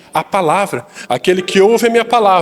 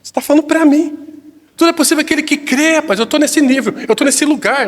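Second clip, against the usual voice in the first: higher-pitched, affronted questioning; mock-indignant retort, pitched up in disbelief; 5 semitones higher than usual. Preacher imagining Jesus answering the doubting father, indignant.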